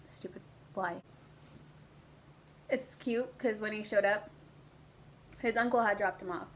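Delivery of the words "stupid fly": muttered whisper under breath. Real, not acted. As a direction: whisper it to yourself. Aside swatting a fly.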